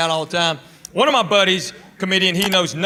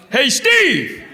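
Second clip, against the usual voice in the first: high, loud call across a cinema; yelling out; 8 semitones higher, 4 dB louder than usual. Retold or imitated. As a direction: yell the name to a stranger. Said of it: "I just yell out".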